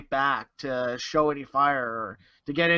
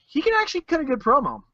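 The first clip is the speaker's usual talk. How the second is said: excited; 9 semitones higher, faster than usual. Real, not acted. Emphatic praise of promo.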